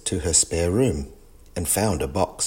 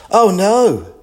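high, loud shocked gasp; a sudden jolt of alarm; 12 semitones higher, 7 dB louder than usual. Acted. Gran finding her bag gone, gasping in shock. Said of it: "gasped Gran".

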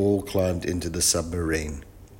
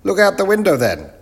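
loud, very high professor voice; muddled professor; 12 semitones higher, 8 dB louder than usual. Acted. Professor mishears the greeting, answers loud and high. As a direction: boom it out high, quite sure of yourself.

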